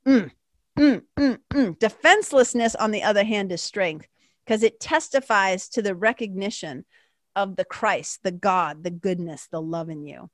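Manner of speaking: ordinary talk, nothing marked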